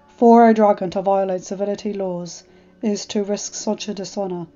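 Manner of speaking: lightly voiced dragon, grave and formal; a hint of a humble old dragon awaiting judgment; solemn dragon voice. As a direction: Nightmare dragon bowing before the alpha, lightly voiced and grave. Nightmare speaks of exile.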